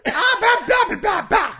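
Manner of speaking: high, loud mock cowboy shouting; hammed-up growly cowboy demo; angry cowboy voice. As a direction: bark nonsense like an angry cowboy. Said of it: "an angry cowboy".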